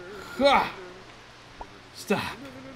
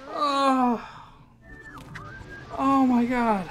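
ordinary delivery

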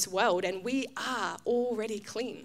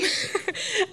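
laugh; 11 semitones higher than usual. Real, not acted. Laughing at her story.

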